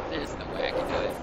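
ordinary talk, nothing marked

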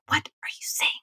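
hushed whisper in church. Retold or imitated. Wife whispers at husband during Mass. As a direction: whisper it sharply so no one hears. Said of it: "I'm whispering".

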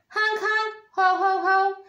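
high honks breaking into laughter; honking gander laugh. A narrator's reading. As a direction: honk and laugh like a goose. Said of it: "The gander laughed".

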